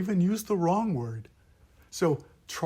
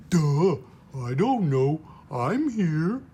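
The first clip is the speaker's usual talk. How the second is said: louder, slow-witted duh; dopey duh voice; 2 semitones higher, 4 dB louder, slower than usual. Shown as a demonstration. Teacher recapping the dopey duh voice. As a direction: say it like a dim character. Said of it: "the five core sounds".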